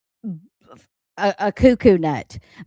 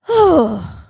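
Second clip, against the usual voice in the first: loud weary sigh; 7 semitones higher, 8 dB louder than usual. Real, not acted. Tired end of rant.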